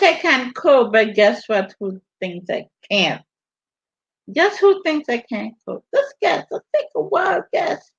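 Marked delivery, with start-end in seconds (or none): 4.3-7.9 higher, teasing sing-song challenge; playful teasing. Real, not acted. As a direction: tease them into guessing, pitched up. Teasing viewers to guess.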